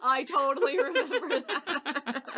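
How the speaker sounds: giggling through a pretend classmate's line; laughing mock recollection. Podcast host playing a former classmate, laughing. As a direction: laugh it out as an old schoolmate remembering. Imagined classmate recalling the story.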